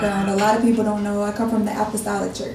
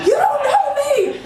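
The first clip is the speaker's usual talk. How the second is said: high, drawn-out indignant retort; retold going off; slower than usual. Worker going off on a customer, retold indignant outburst. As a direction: act out going off on someone, pitched way up. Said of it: "I went off on him".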